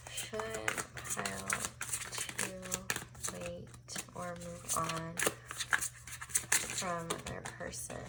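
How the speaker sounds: each word hushed and spaced; slow soft question. Acted. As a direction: ask slowly, almost under breath.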